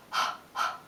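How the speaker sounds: quick unvoiced gasping breath; snatch a panting breath in; sharp gasp. Shown as a demonstration. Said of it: "let me show you".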